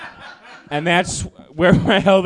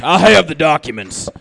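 conspiracy theorist repeat; 3 semitones lower, 3 dB louder than usual. Acted. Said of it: "impression of a conspiracy theorist".

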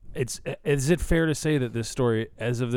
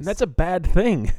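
loud, slow, raised verdict; hammed-up indignant emphasis; mock outrage; 7 semitones higher, 6 dB louder, slower than usual. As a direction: drag it out in comic dismay. Mock outrage over losing wrestlers.

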